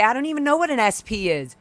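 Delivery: a hint of a playing-dumb owner; mocking the owner. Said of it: "she was trying to say".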